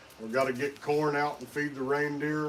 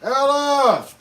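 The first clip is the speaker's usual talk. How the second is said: calling out; 10 semitones higher, 11 dB louder than usual. Acted. Santa calling the child.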